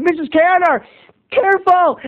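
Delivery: high, urgent warning call; warning shout. Retold or imitated. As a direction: call out in alarm. Calling out warning.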